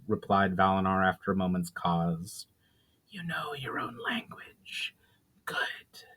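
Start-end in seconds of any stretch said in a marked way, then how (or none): 3.1-6.0 slow, soft, high unvoiced whisper; a spirit breathing the ancient greeting; ghostly whisper. Spirit greeting Valinar in old tongue, ghostly whisper.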